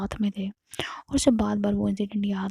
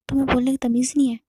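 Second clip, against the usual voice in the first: sharp retort; 3 semitones higher, 7 dB louder, faster than usual. Retold or imitated. A quarrel among the friends.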